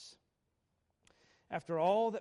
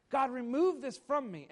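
pleading cry; 7 semitones higher, 7 dB louder than usual. Acted. Paul's plea voiced aloud.

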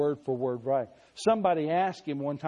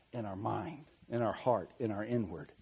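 soft answer; 6 semitones lower, 8 dB quieter than usual. Real, not acted. Preacher quietly answering his own question to the congregation, hushed. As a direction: drop to a near-hush, much softer, on "In our mind, in our heart, in our inward".

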